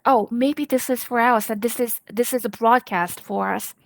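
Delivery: ordinary talk, nothing marked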